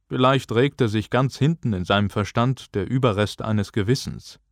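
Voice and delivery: ordinary delivery, nothing marked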